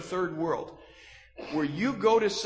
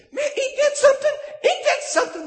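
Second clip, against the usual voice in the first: much higher, laughing inmate exclaiming; amazed prison inmate; 8 dB louder, faster than usual. Retold or imitated. Prison inmate reacting to the preacher, high laughing amazement. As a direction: squeal it out like a stunned inmate through laughter. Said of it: "I heard these guys say".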